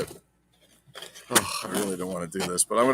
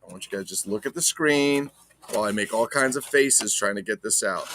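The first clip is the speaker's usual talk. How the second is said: ordinary delivery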